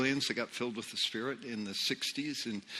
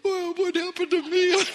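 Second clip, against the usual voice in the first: very high, louder mock whine; mock left-out complaint; 5 dB louder than usual. New believer left out while his partner gets tongues, high mock complaint. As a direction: squeal it as a comic self-imitation. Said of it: "And I'm going like".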